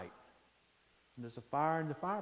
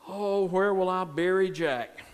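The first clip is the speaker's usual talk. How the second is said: loud, raised lament; loud wondering; 4 semitones higher, 12 dB louder than usual. Acted. Mill owner walking to the mill expecting Jack dead, loud wondering. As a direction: call it out as a big worried question.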